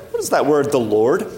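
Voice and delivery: normal talking voice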